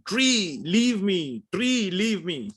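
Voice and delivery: high pleading cry, tree leave me; a guru clinging to a tree, crying out to it; mock shouting at a tree. Guru clinging to a tree, begging it, in a high pleading cry. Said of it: "holding the tree and shouting".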